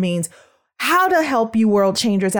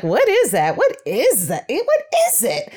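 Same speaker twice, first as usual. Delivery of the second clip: rising puzzlement ending in a much higher squeak on "Eh, what is it"; baffled parent; 8 semitones higher than usual. Retold or imitated. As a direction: play a confused parent, each question more baffled. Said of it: "you're like".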